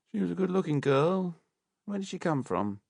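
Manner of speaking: lightly voiced high surgeon; a hint of a casual doctor. A narrator's reading. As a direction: surgeon remarks offhand over the body.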